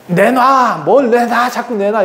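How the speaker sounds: high, acted nagging demands; quarrelling demand. Rachel fights her husband in the tent, high demanding. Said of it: "대판 싸우고 있어요".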